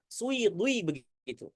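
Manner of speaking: Sui, dui with rounded lips, soft; wrong rounded demo. Shown as a demonstration. Teacher imitating the mistaken reading, softly rounded. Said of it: "Jadi begini bacanya".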